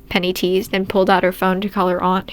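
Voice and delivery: ordinary delivery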